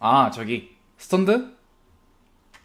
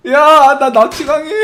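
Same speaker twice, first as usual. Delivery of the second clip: high, loud excited cry; 8 semitones higher, 7 dB louder than usual. Real, not acted. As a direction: blurt it out in giddy alarm. Laughing about being kidnapped in car. (신나서 외치는)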